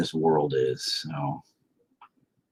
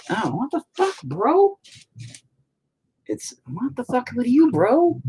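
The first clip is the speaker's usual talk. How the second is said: much higher, indignant what-the; startled outburst; slower than usual. Real, not acted. Reacting to his drawing going wrong, in high startled disbelief.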